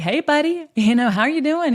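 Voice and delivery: high, loud, friendly hello; hearty greeting. Retold or imitated. Brother greets a stranger at the store, loud cheerful hello. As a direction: call out a big cheerful hey buddy.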